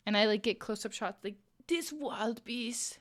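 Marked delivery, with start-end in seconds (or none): 1.7-3.0 slow, hushed-down nature documentary narration; wildlife narrator voice. Pretend. Streamer imagining a nature film about her crochet, slow documentary narrator. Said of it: "introductions with a narrator voice".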